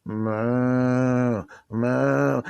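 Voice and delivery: long, drawn-out mooing noise; imitated cow moo. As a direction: moo like a lion mimicking a cow. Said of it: "were literally going".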